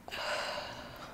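long, soft exhausted sigh; weary sigh. Real, not acted. Patient facing a long day after surgery, weary sigh. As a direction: breathe out slowly and quietly, drained.

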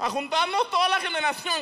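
very high squeaky put-on voice; mocking classmate impression. Comedian mimicking the classmate who organized the reunion, mocking high voice. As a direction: mimic her in a shrieky falsetto.

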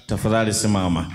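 normal talking voice

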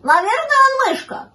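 very high, loud cartoon voices; high-pitched, insistent bickering; squabbling little animals. A narrator's reading. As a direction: argue in a high, piping animal voice. Puppy and kitten argue cat or mouse.

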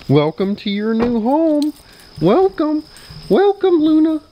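high, drawn-out welcome climbing to a squeal; excited dog talk. Real, not acted. Owner welcomes dog to new home, excited dog talk. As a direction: greet the dog in gushing pet talk.

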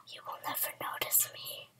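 hushed sneaky whisper. Pretend. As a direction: whisper to stay hidden.

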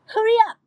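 "Hurry up" thrown out high and loud; punchy pronunciation. Shown as a demonstration. Singer shows a fun lyric delivery, punchy high "Hurry up". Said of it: "มันๆ เราต้องแบบออกเสียงว่า".

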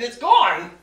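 loud shocked cry; amazed shout. Acted. Mock astonishment.

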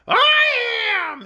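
very high, boastful squawk; high-pitched, self-important orc; proud shrill creature. A narrator's reading. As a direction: declare it proudly in a shrill bird voice. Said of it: "a little proudly".

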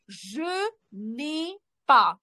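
«je n'ai pas» said very slowly, weight on pas; slow stress on pas. Shown as a demonstration. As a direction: stretch it out and lean on «pas». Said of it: "Тобто". (повільно, з наголосом на «pas»)

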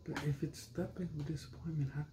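fast whispered tease; quick, hushed mock whisper. Real, not acted. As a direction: whisper back, playing along. Teasing reply to whisper.

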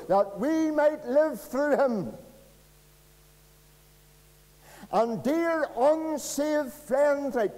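climbing fiery pulpit appeal; preaching at full force. Acted. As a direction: drive the gospel home to the unsaved, slowly.

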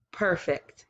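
ordinary delivery

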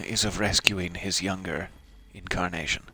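normal talking voice

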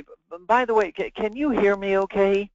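casual aside, then CAN YOU HEAR ME OKAY lifted and held; inflected check-in. Shown as a demonstration. Sales rep checking the prospect can hear, deliberately inflected. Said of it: "did you hear the inflection in my voice?".

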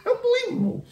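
laughing falsetto unbelievable; squealed catchphrase. Real, not acted. Says it through laughter.

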